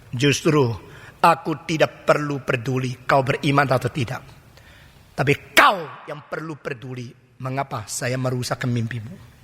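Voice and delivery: a hint of a grave divine voice; God's calm answer. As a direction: answer low and steady, fading into a hush.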